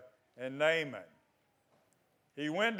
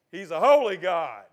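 normal talking voice

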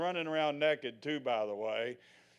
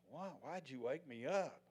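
much softer, drowsy mumble; just woken and mildly annoyed; sleepy Jesus; 3 semitones lower, 15 dB quieter than usual. Retold or imitated. Jesus woken by disciples mid-storm, drowsy grumble.

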